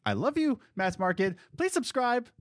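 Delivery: high, hammy declaration of love; mock sappy high voice. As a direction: gush it out in a silly high pitch. Overdone love to audience.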